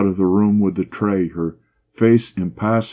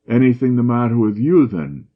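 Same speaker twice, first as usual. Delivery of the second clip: sarcastic; 5 semitones higher, 4 dB louder than usual. A narrator's reading. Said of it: "he asked sarcastically".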